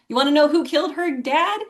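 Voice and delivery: normal talking voice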